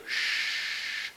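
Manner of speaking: sh hissed soft and unvoiced; sh sound. Shown as a demonstration. Teacher shows the students the sh sound, soft and unvoiced. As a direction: push out a quiet unvoiced sh. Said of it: "We have the sound here".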